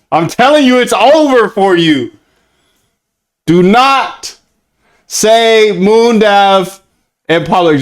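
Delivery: high, insistent mock threat, drawn out; emphatic mock warning. Streamer forbidding fans to name him in public, emphatic mock warning. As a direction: hammer each phrase, stretching the last words slowly.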